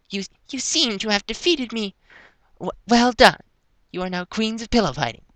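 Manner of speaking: high character voice, haltingly groggy. A narrator's reading. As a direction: concede defeat in a high, stumbling voice. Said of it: "she concluded groggily".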